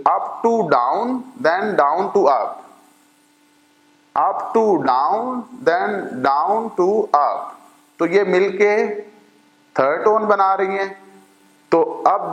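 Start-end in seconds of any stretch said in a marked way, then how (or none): 0.1-7.5 very slow, each word laid out; slow explaining. Shown as a demonstration. Teacher tracing the third tone for students, slow. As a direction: trace the tone contour in words. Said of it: "कि".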